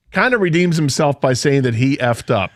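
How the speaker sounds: normal talking voice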